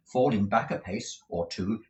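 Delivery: ordinary delivery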